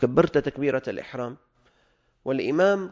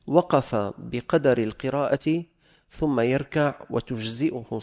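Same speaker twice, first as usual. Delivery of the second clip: slow, emphatic classical Arabic reading; formal text reading; 4 dB louder, slower than usual. Acted. Teacher reads the fiqh text to students, slow and emphatic. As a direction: declaim the ruling phrase by phrase.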